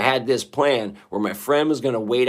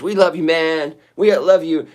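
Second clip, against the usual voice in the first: mock fan gushing; 7 semitones higher, 7 dB louder than usual. Mock fan comments read aloud.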